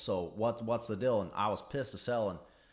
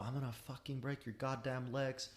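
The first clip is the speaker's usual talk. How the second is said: mild mock threat; 4 semitones higher, 7 dB quieter than usual. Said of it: "starts yelling every profanity in the book".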